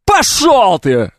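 slow, drawn-out rude brush-off; rude dismissal. Retold or imitated. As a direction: tell them off, slowly. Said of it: "но я это услышал". (грубо и протяжно)

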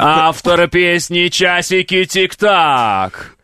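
very slow stretched fighter intro; boxing ring announcer. Pretend. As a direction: drag out the title like an MC. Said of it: "ты как этот, как будто в М М А".

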